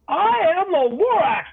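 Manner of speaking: high, loud cry of the Lorax; Lorax shouting. A narrator's reading. The Lorax announcing himself to the Once-ler, in a high loud shout. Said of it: "came back with more gripes".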